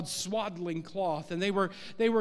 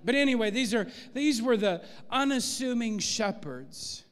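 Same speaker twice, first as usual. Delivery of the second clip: ordinary talk, nothing marked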